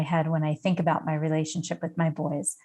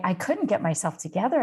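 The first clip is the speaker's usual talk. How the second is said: plain reading voice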